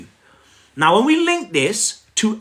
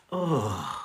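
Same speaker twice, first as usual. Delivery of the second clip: a deflated, much quieter oh; lower, soft dismayed oh; sinking oh; 8 semitones lower, 10 dB quieter than usual. Retold or imitated. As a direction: let the heart drop on one breath. Sinking heart seeing the caller.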